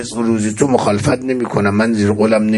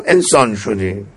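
normal talking voice

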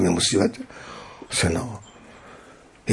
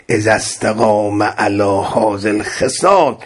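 hadith recitation; 6 semitones higher than usual. Acted. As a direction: intone the saying like scripture from the pulpit. Arabic hadith recited in sermon.

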